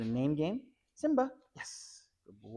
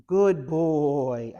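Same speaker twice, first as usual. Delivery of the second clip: loud praise; 9 dB louder than usual. Real, not acted. Trainer rewarding the drop, loud hearty praise.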